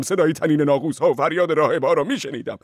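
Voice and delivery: ordinary delivery, nothing marked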